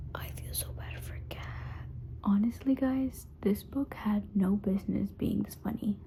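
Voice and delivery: soft, breathy half-whisper; hushed late-night talk. Real, not acted. Reader updating her vlog late at night, hushed.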